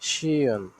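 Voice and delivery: "সিয়ন" drawn out as si-on; demonstrated pronunciation. Shown as a demonstration. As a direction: model "সিয়ন" with a falling end. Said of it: "সিয়ন উচ্চারণ করা হবে".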